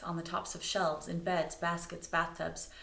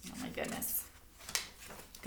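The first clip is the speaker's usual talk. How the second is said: whispered aside; 9 dB quieter, slower than usual. Real, not acted. Aside to class.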